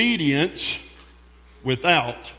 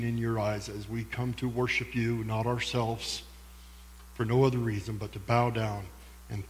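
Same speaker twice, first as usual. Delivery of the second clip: prayer; 6 semitones lower, 7 dB quieter than usual. Acted. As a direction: pray gently to the congregation. Leads a congregation prayer.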